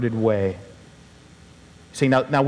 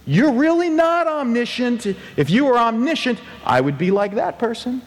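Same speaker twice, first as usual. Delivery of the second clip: high, petulant complaining to God; whiny covetous complaint; 9 semitones higher than usual. Pretend. Covetous believer accusing God of forgetting him, high and whiny. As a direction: whine up at God like a wronged child. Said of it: "Covetousness is saying".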